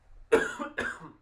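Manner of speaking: sharp choking cough; coughing up water. Real, not acted. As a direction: cough hard as water goes down wrong. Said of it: "Water went down the wrong way".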